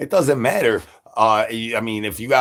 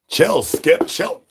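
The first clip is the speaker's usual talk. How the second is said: high, sharp "Chill, Skip"; scolding; 7 semitones higher, slower than usual. Real, not acted. Streamer scolding wound-up Skip, high call. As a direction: tell Skip off.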